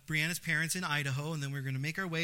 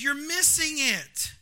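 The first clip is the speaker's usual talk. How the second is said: wife urging; 11 semitones higher, 8 dB louder, slower than usual. Retold or imitated. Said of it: "It's like".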